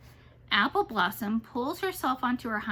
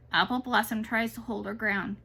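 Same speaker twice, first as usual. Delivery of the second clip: ordinary delivery, nothing marked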